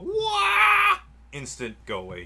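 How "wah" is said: high shouted "Wah"; mock wrestler yell. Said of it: "Anytime he would go".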